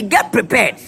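normal talking voice

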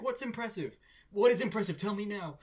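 high-pitched, insistent questioning; frantic demand. Retold or imitated. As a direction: imitate a flustered man demanding answers. Retold panicked demand for an answer.

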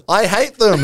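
lightly acted pronouncement from a spirit; Margaret Thatcher. Medium answers on trade unions as Thatcher, mock disdain. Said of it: "And he says".

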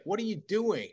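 high, startled "what are you doing"; mock incredulous. Norm asks Kevin why he slammed him, high incredulous. Norm baffled after the body slam.

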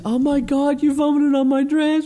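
very high-pitched imitated freak-out; mock screaming bride. Bride finding her dress vomited on, shrieky mock horror. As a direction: act out a horrified woman, voice way up. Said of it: "she screams".

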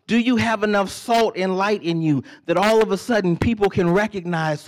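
high, loud, driving preacher voice; preaching at full force. Real, not acted. Preacher pressing the congregation about Christmas dinner, loud and high. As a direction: preach it out, rising with fervor. Preacher building intensity at pulpit.

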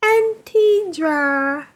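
squeaky high sucked-helium voice. Pretend. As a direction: read it like you just inhaled helium. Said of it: "to be read in a high-pitched aluminum hel-, helium voice".

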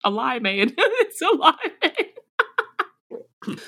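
giggling out the word; laughing at the pun. Real, not acted. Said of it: "repeats her mishearing, laughing".

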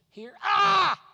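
sharp shouted exclamation; startled loud cry; sudden shout. Retold or imitated. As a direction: bark it out like a jab. Holy Spirit pointing, shouted.

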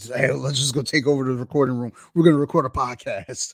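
mildly hyped, as if rounding up a friend; eager suggestion. Retold or imitated. Host retelling their plan to take over the recording room, eager.

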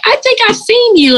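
very high-pitched mock fan voice; imitated onlookers. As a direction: squeal it like someone half-recognising a celebrity. Imitating people who recognise her.

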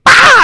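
acting out a scream at the scary car ad; mock scream. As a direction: scream like a jump scare. Acted jump scare scream.

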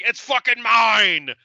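slow, loud, possessive hammed-up shout; mock slow yell. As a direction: drag it out like a madman clutching his sweater.

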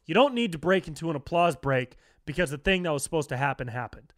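ordinary delivery

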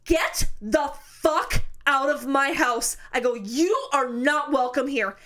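slow, deliberate order to an intruder; slow retold standoff, standing your ground; firm command. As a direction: space the words out, each one weighted.

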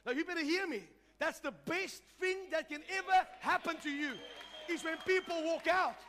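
high, loud preacher's declaration; full-force testimony preaching. Real, not acted. Pastor testifies God changed his life, full-force preaching.

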